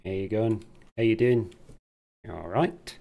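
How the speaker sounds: loud, punchy imitation English greeting; mock English accent. English bandsman greeting his crowd, loud mock English accent. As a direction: call out in a put-on English accent. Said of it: "In his English voice".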